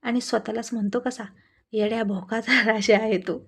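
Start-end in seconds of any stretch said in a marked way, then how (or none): 1.7-3.4 laughing through the words; laughing at himself. Acted. Husband laughing at himself as a silly king. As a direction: chuckle as you mock yourself. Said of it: "आणि तो हसला".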